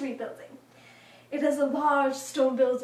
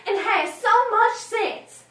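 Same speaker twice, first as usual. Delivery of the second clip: high, loud, drawn-out praise; gushing admirer; 8 semitones higher, 8 dB louder, slower than usual. Acted. Churchgoers adding praise for the girl, gushing. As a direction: lay the compliment on slowly and loudly.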